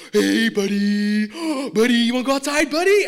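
high, loud, gushing dog-owner voice; excited dog-owner voice. Shown as a demonstration. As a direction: gush at your dog like he's the best boy. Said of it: "you don't use your normal voice".

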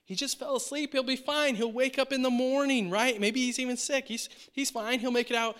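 high, easygoing dismissal; breezy reassurance. Retold or imitated. Disciples telling Jesus Lazarus is fine, high breezy dismissal. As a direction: wave it off like it's nothing.

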